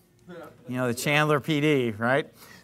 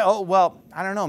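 usual speaking voice

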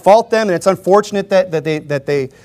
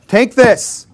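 pushy mock offer; 6 semitones higher than usual. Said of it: "shoving it through the door".

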